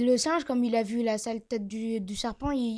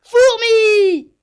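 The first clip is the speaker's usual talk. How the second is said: shout; 10 semitones higher, 16 dB louder than usual. Acted. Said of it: "il lui crie".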